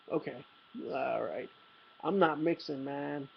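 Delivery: soft, low, sheepish refusal; meek refusal. Retold or imitated. Narrator refusing the mixed drinks at the bar, soft sheepish mumble. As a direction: quietly back out of the drinks.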